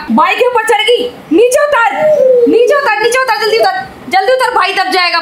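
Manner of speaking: high, repeated urgent orders; urgent scolding. Real, not acted. Urgently ordering child off bike.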